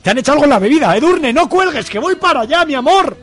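high-pitched, mounting panic into the phone; frantic lover crying out not to hang up; alarmed. Acted. Radio play: alarmed lover on the phone.

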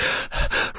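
demonstrated out-of-breath gasp; mock winded gasping. Shown as a demonstration. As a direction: huff as if running out of air. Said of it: "run out of breath".

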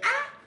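high short shriek of "ah"; mock scream from the dark; retold scream. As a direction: yelp it out, very high. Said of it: "Yo grité".

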